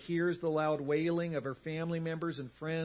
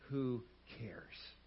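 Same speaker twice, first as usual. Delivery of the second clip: hushed, tender; 3 semitones lower, 8 dB quieter than usual. Real, not acted. Preacher on a God who cares, hushed. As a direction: drop to a near-whisper. Tender close of quoted passage.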